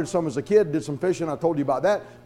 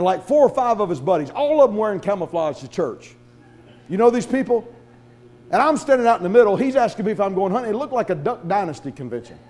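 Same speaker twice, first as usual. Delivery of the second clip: ordinary delivery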